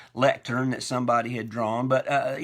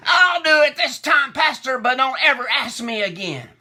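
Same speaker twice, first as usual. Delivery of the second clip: much higher, loud, angry parting shot; agitated shout; 12 semitones higher, 11 dB louder than usual. Retold or imitated. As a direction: yell it over your shoulder, fed up. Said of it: "with clear agitation in her voice".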